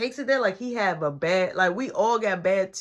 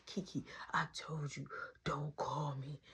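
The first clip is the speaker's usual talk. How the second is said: soft, hushed scolding; hushed retold telling-off; secretive whisper; 6 semitones lower, 16 dB quieter than usual. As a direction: whisper it into the phone so nobody hears. Secret phone call, nana nearby.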